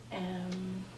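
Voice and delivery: usual speaking voice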